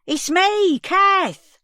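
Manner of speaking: put-on old woman shouting through the glass door to reach Sandy. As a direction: call out over the din, rising each time. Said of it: "I shouted as best I could".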